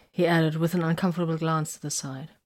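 ordinary delivery, nothing marked

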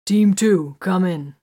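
loud radio call; calling on comms. A narrator's reading. Captain calling team two over the radio, loud.

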